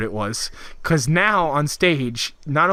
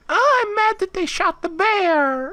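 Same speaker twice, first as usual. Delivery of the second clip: very high, drawn-out silly wail; hammed-up high-pitched moaning; mock whining complaint; 3 dB louder, slower than usual. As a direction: whine it slowly in a squeaky put-on voice. Mocking complainers' goof.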